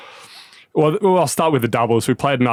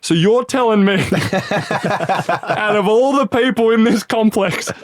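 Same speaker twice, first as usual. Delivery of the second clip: high, slow, laughing mock disbelief; incredulous mockery; 9 semitones higher, slower than usual. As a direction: roast the fan with amused disbelief. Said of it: "He's pointed at him, he's like".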